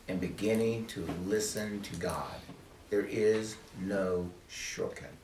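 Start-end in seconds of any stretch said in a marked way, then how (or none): 2.9-5.1 "There is no shortcut" drawn out word by word; slow emphasis. Shown as a demonstration. Teacher insists to the team on no shortcut, slow and emphatic. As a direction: weigh each word of "no shortcut", very slowly. Maxim repeated slowly for emphasis.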